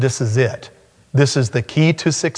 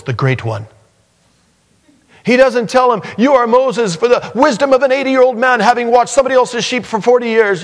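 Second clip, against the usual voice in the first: higher and louder, full-force sermon voice; fiery preaching; 9 semitones higher, 4 dB louder than usual. Acted. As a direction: preach it out with mounting fervour.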